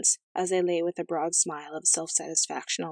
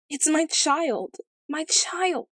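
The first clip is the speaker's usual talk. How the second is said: high, drawn-out wail; acted sobbing; 10 semitones higher, much slower than usual. A narrator's reading. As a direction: wail slowly like a distraught queen, pitched far up. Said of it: "sobbed her majesty".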